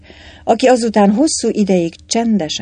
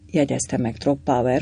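usual speaking voice

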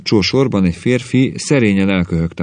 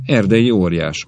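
ordinary delivery, nothing marked

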